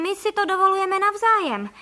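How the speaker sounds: high, insistent self-justification; defensive answer. A narrator's reading. Hunter cornered by the duck's question, answering defensively. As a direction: justify yourself, pitch and volume up.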